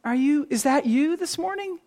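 usual speaking voice

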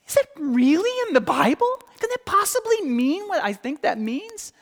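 high, loud, astonished questioning; incredulous doubters. Retold or imitated. Doubting faces in Sunday school react to a hard doctrine, incredulous. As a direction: act out taken-aback disbelief, pitched well up. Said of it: "I see some faces like".

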